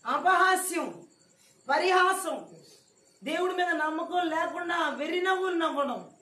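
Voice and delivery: thundering sermon delivery; fiery preaching. Acted. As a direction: cry the sins out to the crowd. Preacher names sins forcefully.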